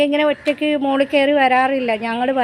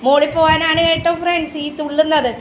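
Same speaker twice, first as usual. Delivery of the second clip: normal talking voice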